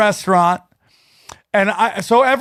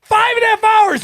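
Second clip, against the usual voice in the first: emphatic; 12 semitones higher than usual. Real, not acted. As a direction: shout it like it's unbelievable. Repeating the shocking number.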